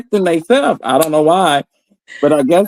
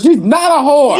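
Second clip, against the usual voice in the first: anguished crying shout; 7 semitones higher than usual. Retold or imitated. Lovestruck defense, crying shout.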